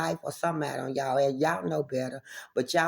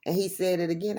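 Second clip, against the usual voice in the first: ordinary delivery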